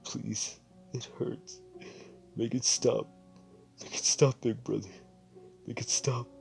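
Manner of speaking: high, breathy whimpering plea; pained whimper. Acted. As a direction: beg through the pain like a hurt child. Wounded child in pain.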